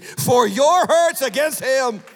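slow, loud and rising toward the climax. Acted. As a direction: hammer the last words home slowly.

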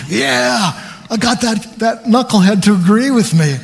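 a hint of a gloating devil, pleased with himself. The devil gloating over his victory, lightly voiced and smug. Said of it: "what does he think of that".

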